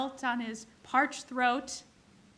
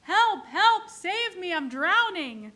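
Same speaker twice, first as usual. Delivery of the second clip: higher, louder mock cries for help; frantic screams; 6 semitones higher, 7 dB louder, slower than usual. Drowning man calling to soldiers, mock frantic yell. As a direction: play the drowning man yelling, half comic. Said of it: "he frantically screams".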